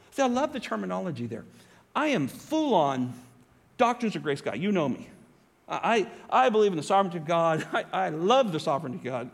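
normal talking voice

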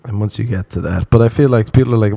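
ordinary talk

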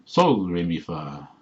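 sudden emphasis on sol, the rest quick and light; sharp accent on first syllable. Shown as a demonstration. Showing the noun for song, sharp stress on SOL. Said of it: "then it's a sharp accent".

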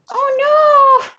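very high, squeaky mock distress; tiny helpless victim. Comic acting out her final game's victim, squeaky panic. Acts out character in final game.

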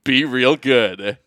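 loud, drawn-out catchphrase; catchphrase sign-off. Acted. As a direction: belt out the show slogan.

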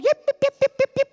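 high, loud yapping dog yips; puppy yips. Retold or imitated. Little dog yapping at the lady's heels. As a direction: yap like a tiny dog at her heels. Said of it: "that little dog".

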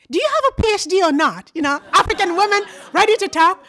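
fired-up scolding. Retold or imitated. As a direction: rouse her like a feisty friend. Friend scolding her to speak up.

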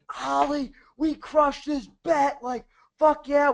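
high, slow triumphant retold cheer; excited cheer. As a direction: crow over a won bet. Retelling celebrating winning the bet.

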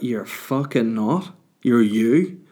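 ordinary delivery

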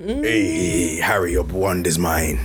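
low, slow villain voice; Lord Voldemort. Pretend. Guest introduced as Voldemort greets Harry, low villain voice. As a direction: menace played for laughs, drawn out low. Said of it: "we have Lord Voldemort himself".

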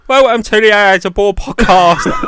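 mock podcast host, high and loud; hammed-up radio host intro; mock Tom intro. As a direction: send up your co-host's stiff welcome. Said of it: "than just Tom's like".

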